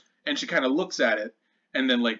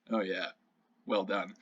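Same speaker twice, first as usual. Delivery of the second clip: side-eye sarcasm; 5 semitones lower, 7 dB quieter than usual. Acted. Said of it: "gives Durin the side eye".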